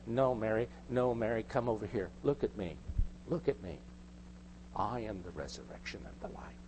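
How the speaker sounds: soft, hushed and tender, quiet; gently. Retold or imitated. Jesus gently calling Mary to look at him, tender and hushed. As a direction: speak it gently, as if close to her. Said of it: "Jesus sort of gently said".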